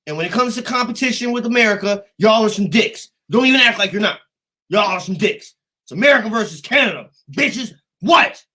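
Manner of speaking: high, loud playful taunting; mock trash talk. Gamer ribbing Canadians over competition, loud mock challenge. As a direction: goad a friendly rival at full voice.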